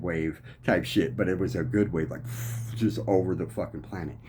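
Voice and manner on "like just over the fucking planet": ordinary delivery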